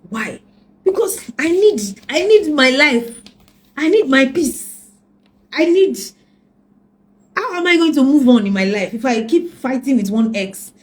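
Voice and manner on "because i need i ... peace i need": loud plea. Real, not acted. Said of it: "pleading for peace".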